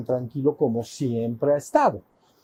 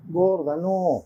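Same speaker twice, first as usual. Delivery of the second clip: higher, teasing refusal to his wife; lazy husband refusing; 4 semitones higher, slower than usual. Retold or imitated. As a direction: brush her off lightly. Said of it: "Y entonces Shiva le dice".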